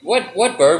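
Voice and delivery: usual speaking voice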